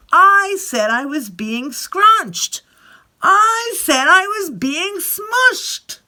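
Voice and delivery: put-on drawn-out childish whining; whiny complaint.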